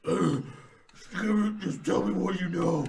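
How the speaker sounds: loud, slow strained rasp; raspy Batman. Retold or imitated. Imitating Batman interrogating a crook, loud and raspy. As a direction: growl it out through a failing voice. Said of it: "at the very end he gets a raspy".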